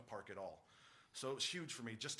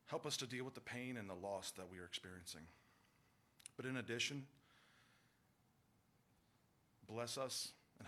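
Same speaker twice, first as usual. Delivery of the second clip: earnest spoken prayer to God. Acted. Prayer at the funeral.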